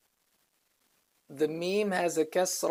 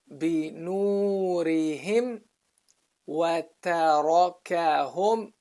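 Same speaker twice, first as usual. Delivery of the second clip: Quran recitation. Shown as a demonstration. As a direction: recite it for students to follow. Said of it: "We'll re-, repeat from here".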